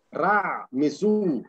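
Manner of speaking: "Ra Mesú" as two slow, drawn-out words; slow, deliberate Egyptian name "Ra Mesú"; Egyptian pronunciation. Shown as a demonstration. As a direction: stretch "Ra", then "Mesú" stressed on the last syllable. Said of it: "pronunciar bien los nombres egipcios".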